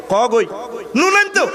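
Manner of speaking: a wife screeching an order at her husband; mock shrill yell. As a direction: bark it at the husband.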